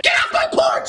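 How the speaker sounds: sudden high yell; Roo. Acted. Roo orders them off the porch, yelling. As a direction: burst out with a high holler. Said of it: "And Roo said".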